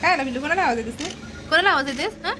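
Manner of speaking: quick high cooing to the cat. Real, not acted. Cooing to pet.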